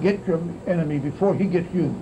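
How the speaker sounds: ordinary delivery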